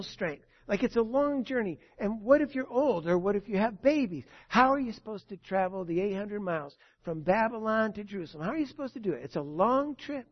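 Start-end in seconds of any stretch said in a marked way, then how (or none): none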